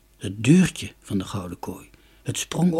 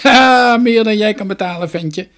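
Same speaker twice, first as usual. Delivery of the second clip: higher, louder scornful jeer; mocking; 9 semitones higher, 10 dB louder than usual. A narrator's reading. Merchant scoffs at the boy, mocking. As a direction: sneer down at the little boy. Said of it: "lachte spottend".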